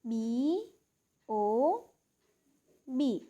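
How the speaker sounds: બી, ઓ, બી as separate letter names, paused between; letter-by-letter spelling. Shown as a demonstration. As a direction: spell out બી... ઓ... બી for pupils. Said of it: "બીજી વખત".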